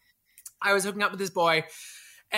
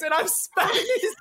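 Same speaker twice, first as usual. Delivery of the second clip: usual speaking voice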